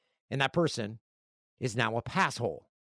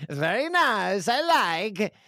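high, slow catchphrase impression; Borat; 7 semitones higher, 4 dB louder, much slower than usual. Host reading fan mail as requested, Borat accent. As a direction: put on a broad comic Kazakh film-character accent. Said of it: "Say that in a Borat accent".

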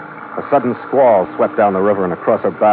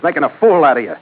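taunting; 10 semitones higher, 4 dB louder than usual. Acted. Taunting the Colonel.

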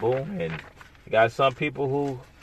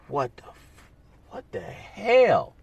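shocked disbelief; 9 semitones higher, slower than usual. Retold or imitated. Imagined smoker hears cancer diagnosis.

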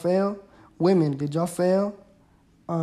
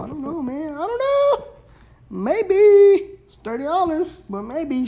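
playful indecision; 10 semitones higher, 4 dB louder than usual. Real, not acted. Unsure about buying Mulan.

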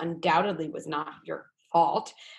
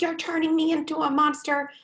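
higher, louder imitation of her own yelling; mock angry parent; 10 semitones higher, 4 dB louder than usual. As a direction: replay your own outburst, hammed up. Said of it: "I even said something like that to you".

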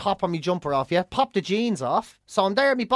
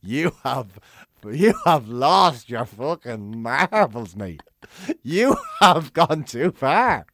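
giggling to myself; 7 semitones lower, much slower than usual. Retold or imitated. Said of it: "giggling to myself going".

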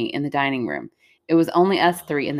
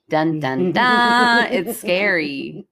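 mock-dramatic suspense sting; much slower than usual. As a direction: tease it like a horror sting, then drawn out.

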